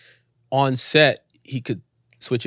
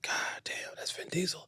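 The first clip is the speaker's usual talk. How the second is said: hushed, starstruck whisper; awed whisper; 6 semitones higher, 14 dB quieter than usual. Retold or imitated. Star-struck actor to himself on set, awed whisper. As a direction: whisper it like a private thought in disbelief. Starstruck inner thought acted out.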